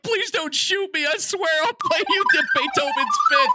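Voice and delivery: high-pitched comic pleading; mock scared. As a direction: beg for your life in a squeaky panic. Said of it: "They look so scared".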